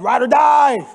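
loud bro-style vow; mock shout. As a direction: disciples hyping their loyalty, shouted. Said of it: "All the disciples are like".

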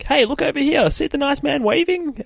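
very high, sing-song bait call; creepy lure voice. Retold or imitated. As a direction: coax them over like a shady van man. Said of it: "there's a dude saying".

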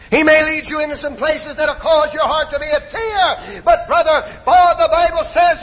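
high, driving pulpit voice; high-pitched revival exhortation; full-force preaching. Acted. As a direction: build to a loud, triumphant cry.

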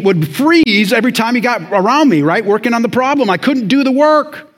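high, loud, urgent storytelling from the pulpit; impassioned preaching. Real, not acted. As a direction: tell it at full preaching intensity. Preacher at full force.

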